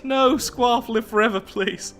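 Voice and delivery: high-pitched playful begging; mock pleading. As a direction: beg in a high voice, hammed up. Mock plea to aged character.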